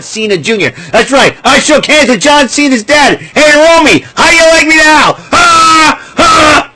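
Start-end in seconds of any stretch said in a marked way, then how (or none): 3.2-6.7 higher, louder gloating shout ending in barked laughs; hammed-up gloating screamer; Sam Kinison yell. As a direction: shout it like a ranting comic. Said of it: "Trying to do the Sam Kinison thing".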